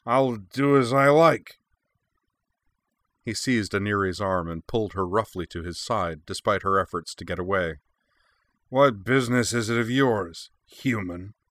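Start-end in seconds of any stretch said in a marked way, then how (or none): 0.0-1.6 slow, higher, louder Centauri drawl; defiant like a drunken noble; arrogant Centauri official. A narrator's reading. Vice consul defying the young mercenary, slow arrogant Centauri drawl. 8.7-11.4 put-on defiant like a drunken noble; arrogant Centauri official. A narrator's reading. Vice consul voiced.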